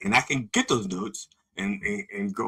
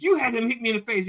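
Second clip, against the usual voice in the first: put-on accusing kid; 11 semitones higher, 3 dB louder, faster than usual.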